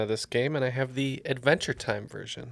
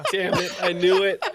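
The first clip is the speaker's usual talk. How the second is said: laughing; 8 semitones higher than usual. Real, not acted. Laughs at a guessed team name.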